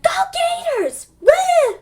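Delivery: imitated sports fan's whoop, shooting up high; cheering. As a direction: cheer for the team from the back. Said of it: "Emmett starts cheering in the back".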